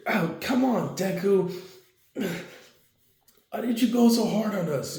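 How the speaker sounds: put-on high, childish complaining voice; whiny kid voice. Kids complain to Deku after brutal training, whiny high child voice. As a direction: whine it like a worn-out kid.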